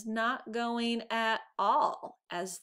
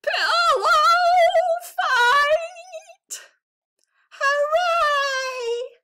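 much higher, much louder cheering yell; joyful shout; 13 dB louder, much slower than usual. A narrator's reading. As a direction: cheer like a crowd wild for a pillow fight. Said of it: "appeared and shouted".

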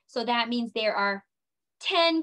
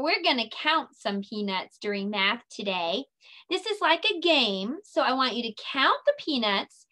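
ordinary talk

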